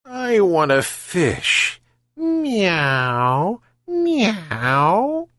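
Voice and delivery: slow, high, fake-sweet cat voice; phony purr. Sly cat begs the old man for fish, phony purr. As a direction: purr the demand like a scheming cat. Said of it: "with a phony purr".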